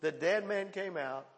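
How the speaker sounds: ordinary talk, nothing marked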